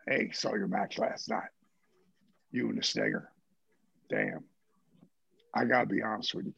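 put-on low, gruff older wrestler voice; Michael on the phone. Michael leaving a message admitting he was wrong, low and gruff. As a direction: drop low like a grizzled veteran conceding. Voicing Michael on answering machine.

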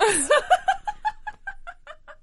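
shrill laugh; high burst of laughter. Real, not acted. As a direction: crack up high. Laughs at own read.

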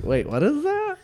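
put-on high confused kid voice. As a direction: squeak it like puzzled kids. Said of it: "They're like".

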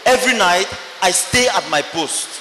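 normal talking voice

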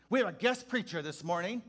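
high, loud, hearty preacher greeting; jolly pastor. Retold or imitated. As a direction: boom it out like a beaming old reverend. Pastor announcing from pulpit.

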